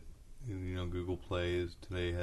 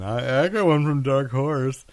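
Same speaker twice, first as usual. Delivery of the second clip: said again much louder and clearer; louder retake; 4 semitones higher, 12 dB louder than usual. Shown as a demonstration. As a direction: repeat it loud for the mic. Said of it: "I'll say it again".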